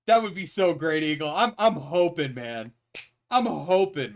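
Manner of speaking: ordinary delivery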